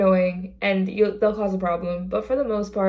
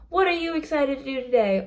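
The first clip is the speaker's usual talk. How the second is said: put-on cheery question to child; 6 semitones higher than usual. Cast member chatting with kid.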